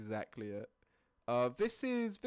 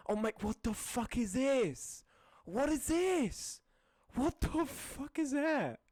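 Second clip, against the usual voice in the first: baffled disbelief; 7 semitones higher, slower than usual. Real, not acted. Reacting to a bizarre image.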